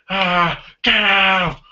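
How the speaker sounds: high mock yell; hammed-up manic outburst; over-the-top crazy villain. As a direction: imitate a crazed masked man screaming. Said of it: "Santa's really over the top crazy".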